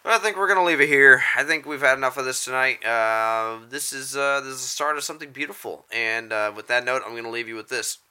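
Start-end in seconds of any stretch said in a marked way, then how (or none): 0.0-5.9 announcer sign-off. Pretend.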